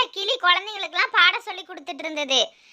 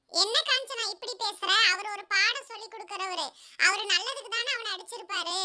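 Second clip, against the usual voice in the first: soft, small character voice; teacher's defending spouse; 10 dB quieter than usual. Acted. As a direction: reason quietly with an angry parent. (மெல்லிய குரலில் சமாதானம்)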